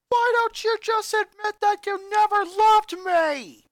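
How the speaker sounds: high, loud, slow furious scream; anguished yell. A narrator's reading. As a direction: yell it at her, heartbroken and enraged. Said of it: "the boy yelled out".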